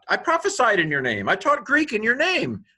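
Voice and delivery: high-pitched, pleading insistence; desperate protest. Acted. Believer protesting to the Lord at judgment, desperate.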